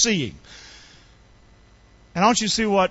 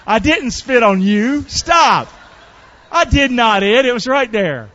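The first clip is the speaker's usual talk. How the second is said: mock protest; 2 semitones higher, 5 dB louder, slower than usual. Real, not acted. Mock protest to congregant about spit.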